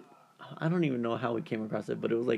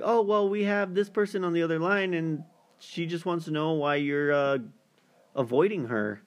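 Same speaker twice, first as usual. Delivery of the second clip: higher, lightly imitated hosts; show hosts; 6 semitones higher than usual. Hosts explaining the call to the avoiding date, higher lightly voiced. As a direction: raise the pitch as the hosts explaining. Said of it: "And they're like".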